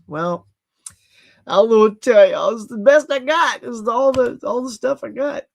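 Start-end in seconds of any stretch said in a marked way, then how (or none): 1.5-5.4 raised, louder exasperated plea; exasperated protest. Real, not acted. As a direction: throw up a defence, higher and louder. Defends frozen camera to viewers.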